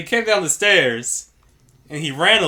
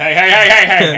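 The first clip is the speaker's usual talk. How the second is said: louder repeated hey to shoo someone; calling out hey; 5 semitones higher, 12 dB louder than usual. Retold or imitated. Coffee shop staff shooing out a laptop guy, loud calls.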